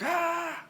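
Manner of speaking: high-pitched exasperated "ah"; overwhelmed cry. Retold or imitated. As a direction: imitate a wife's overwhelmed outburst, pitched high. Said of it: "she's just overwhelmed".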